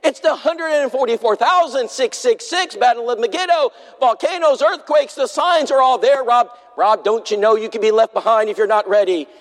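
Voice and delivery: high, urgent, hammed-up alarm; preacher imitating a frantic friend warning of the end times, in mock alarm; mock doomsday panic. Urgent rising prophecy rant, played for laughs.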